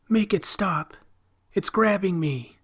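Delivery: high, loud frantic plea; boy seized by something, screaming; terrified pleading. Acted. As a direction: beg in terror for it to stop.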